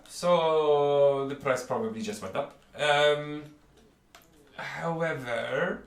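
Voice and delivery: high, loud merchant voice full of polite menace; a fussy shopkeeper warning customers off his stock. Acted. Shopkeeper warning the players off the bottle, high and polite.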